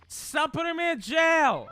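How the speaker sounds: high, loud, drawn-out complaint; exasperated yell. Real, not acted. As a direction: yell it in frustrated protest. Yelling at chat's jail punishments.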